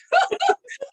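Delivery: real high-pitched burst of laughing; high loud laughter. Real, not acted. Laughing with friend over the rouge story, high laughter. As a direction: crack up loudly at your own story.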